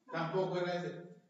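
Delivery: usual speaking voice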